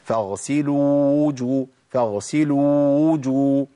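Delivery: "فاغسلوا وجو" with the two waws kept apart, slow; separated waws. Shown as a demonstration. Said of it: "لابد من بيان الفرق".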